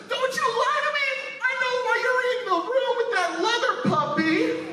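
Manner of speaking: high, shrill mock tearful screaming; crying with rage. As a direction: imitate a woman sobbing furiously. Woman's breakup rant imitated.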